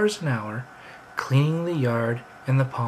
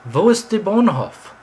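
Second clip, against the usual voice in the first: textbook German question, louder and higher; German phrase; 6 semitones higher, 7 dB louder than usual. Shown as a demonstration. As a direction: say it like a phrasebook learner. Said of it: "one of the first things that they teach you is".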